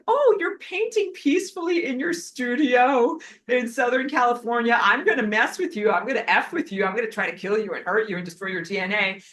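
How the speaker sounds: high, loud sneering villain voice; mock taunting villains. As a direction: taunt her like a cartoon bully. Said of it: "They're like".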